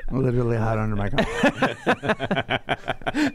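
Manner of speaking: slow, laughing through the words. Real, not acted. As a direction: chuckle it out. Laughing at a joke about himself.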